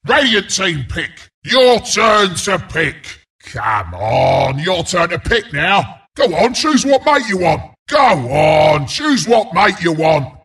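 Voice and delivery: ordinary talk, nothing marked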